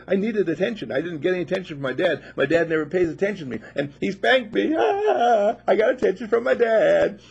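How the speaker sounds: put-on high, loud, rushing kid voice building to a yell; gleeful child crowing over getting noticed; excited little boy. Spanked boy telling about his dad's attention, excited high yell.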